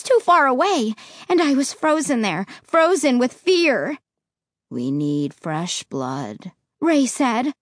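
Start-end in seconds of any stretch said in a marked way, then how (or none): 4.7-6.5 deep, slow and cold; low, flat tone. Acted. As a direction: lower and softer, slow and lifeless. Ray voiced low.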